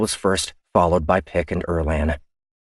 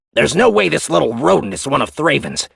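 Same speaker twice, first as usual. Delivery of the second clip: higher, louder hostile reply; defiant crewman; 9 semitones higher, 5 dB louder than usual. Acted. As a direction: challenge her openly. Otero voice.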